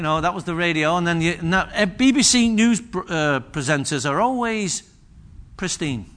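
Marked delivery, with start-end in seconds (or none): none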